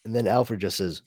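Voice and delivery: usual speaking voice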